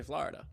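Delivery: "Florida" with an open "ah"; New York pronunciation. Shown as a demonstration. New Yorker explains the local "Florida", said FLAH-rida. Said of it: "people in New York say".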